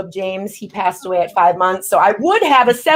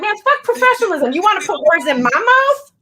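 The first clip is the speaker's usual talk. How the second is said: much higher, heated comeback; defiant retort; 10 semitones higher than usual. Retold or imitated. Rapper snapping back at her manager Mike, defiant. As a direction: brush the manager off, fired up.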